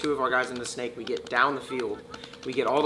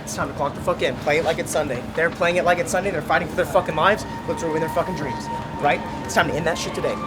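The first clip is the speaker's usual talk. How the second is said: fired-up locker room speech; rally the team, loud and driving; halftime pep speech; 3 semitones higher, 4 dB louder, faster than usual. Real, not acted. Team huddle before a match.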